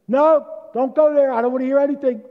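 a president flatly shutting his friend down at full voice; angry refusal. Acted. Truman shouting down his pleading friend Eddie, high and loud.

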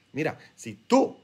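ordinary talk

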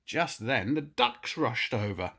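ordinary delivery

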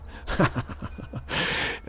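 short chuckle after his own joke; amused burst of laughter. Real, not acted. Laughs after mocking blame quote.

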